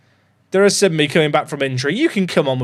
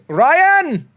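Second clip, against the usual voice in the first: cheering shout; 11 semitones higher, 4 dB louder than usual. Real, not acted. Cheering a player.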